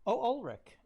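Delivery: high, loud exaggerated "oh"; mock surprise. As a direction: pretend to be startled to find him there. Feigned surprise at seeing Ulrich.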